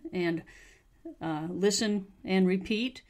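ordinary delivery

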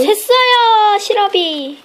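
sudden high surprised cry; high-pitched dismay at a leak. Real, not acted. Discovers leaking syrup.

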